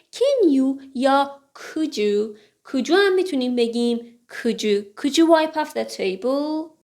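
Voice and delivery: normal talking voice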